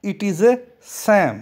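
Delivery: very slow, clear model reading; slow model phrase. Shown as a demonstration. Teacher modelling it slowly for students. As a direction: say each word slowly for learners. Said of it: "इस प्रकार से भी कह सकते हैं".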